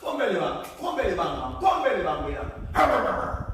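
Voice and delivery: loud chanted dog call. Acted. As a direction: call the dogs out. Calling the dogs.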